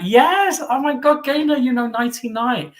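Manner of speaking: very high, loud squeal of recognition; delighted excitement. Real, not acted. Thrilled that Gaynor knows the comedy, excited outburst. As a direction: burst out thrilled.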